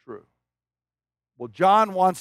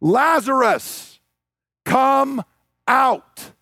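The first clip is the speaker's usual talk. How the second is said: a commanding call, drawn out and raised; loud call; 5 semitones higher, much slower than usual. Retold or imitated. Jesus calling dead Lazarus out of the tomb, loud commanding call. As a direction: call him out of the tomb with authority. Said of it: "Jesus called out in a loud voice".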